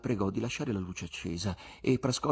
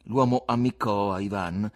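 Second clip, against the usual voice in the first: ordinary delivery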